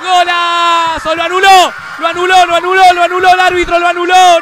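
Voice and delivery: screaming goal call, long held golazo; breakneck goal commentary. Real, not acted. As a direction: yell the goal with everything.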